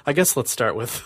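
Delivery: ordinary talk, nothing marked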